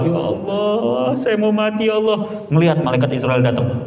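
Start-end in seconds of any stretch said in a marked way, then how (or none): 0.1-2.3 tearful prayer. Acted. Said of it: "dia lagi bahasnya doa". ([name] berdoa sambil pura-pura menangis)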